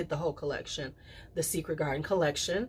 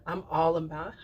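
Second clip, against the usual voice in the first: ordinary delivery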